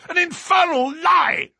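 loud, very high-pitched cry; outraged shout. A narrator's reading. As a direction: shout a furious denial. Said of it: "he shouted".

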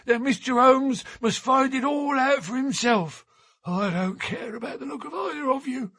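put-on high, rising old man's protest, quickening at the end; a crusty old seaman digging his heels in; indignant old sailor. A narrator's reading. Old sailor refuses to wait for Holmes, indignant and high.

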